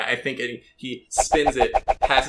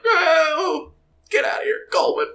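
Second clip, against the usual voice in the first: shrill, much higher frantic cry; panicked dealer. Acted. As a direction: beg him to get out, squealing. Dealer begging Coleman leave.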